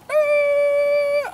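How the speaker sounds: very high, loud electronic squeal; shrill mock buzzer noise; alarm beep imitation. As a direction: mimic the bite alarm going off. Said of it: "it went".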